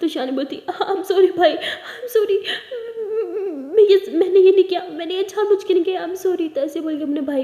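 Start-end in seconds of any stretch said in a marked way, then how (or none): 0.8-6.5 higher, louder tearful sorry; tearful apology. Acted. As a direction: beg forgiveness through tears. Ishani hurt brother by accident.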